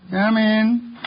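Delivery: usual speaking voice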